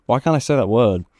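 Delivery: ordinary talk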